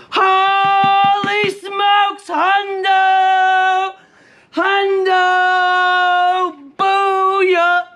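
very high, long-drawn victory holler; sustained, almost sung screams of triumph; excited yelling. Real, not acted. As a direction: yell it at the camera, elated by a big win. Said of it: "I'm yelling at the camera".

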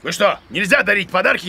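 usual speaking voice

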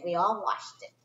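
ordinary delivery, nothing marked

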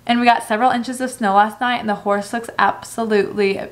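usual speaking voice

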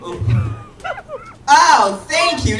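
usual speaking voice

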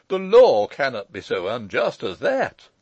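put-on loud protest from the old uncle; crying out. A narrator's reading. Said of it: "cried Joseph".

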